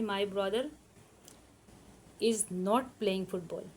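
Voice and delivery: very slow, word by word for students to write; slow, careful dictation pace. Shown as a demonstration. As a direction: spell it out slowly for the class to copy. Teacher dictating English sentence.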